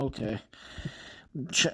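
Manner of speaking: usual speaking voice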